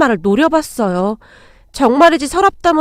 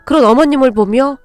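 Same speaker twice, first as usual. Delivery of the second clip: plain reading voice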